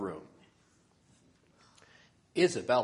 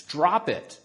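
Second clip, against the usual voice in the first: loud, raised-pitch order to a dog; firm command; 6 semitones higher, 7 dB louder than usual. A narrator's reading. Isabella to the puppy holding her slipper, commanding firmly.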